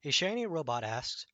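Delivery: plain reading voice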